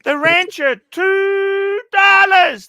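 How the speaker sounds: high, loud and very slow drawn-out mockery; mock grand announcement. Mocking a listener's tiny two-dollar donation, high drawn out. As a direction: stretch "two dollars" out as if it's a fortune.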